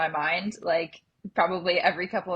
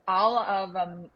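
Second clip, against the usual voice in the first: monotone voice; slower than usual. Shown as a demonstration. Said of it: "say it in, like, such a normal voice".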